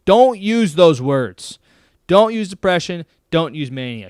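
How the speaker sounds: loud, slow, forceful ban on the words; emphatic don't. Real, not acted. Host scolding himself about words, loud and firm. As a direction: slam down the word ban. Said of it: "Shame on me for even using the word".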